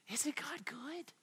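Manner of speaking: slow, soft whisper of awe. Real, not acted. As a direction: breathe it in wonder. Whispered aside in sermon.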